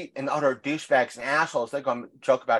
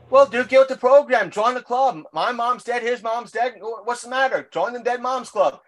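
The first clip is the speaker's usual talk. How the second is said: mock cheerful taunt; 8 semitones higher than usual. To the grieving coworker, a high cheeky taunt. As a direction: deliver the punchline like a gleeful welcome. Retelling his joke to coworker.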